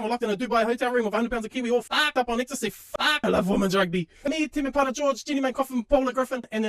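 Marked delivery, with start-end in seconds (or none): none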